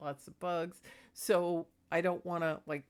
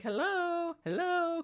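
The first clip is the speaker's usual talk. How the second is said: very high, louder mewing hello; cat saying hello; 10 semitones higher, 4 dB louder, slower than usual. Retold or imitated. Cat Magic waking her up, with a high squeaky hello. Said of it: "And I'm like".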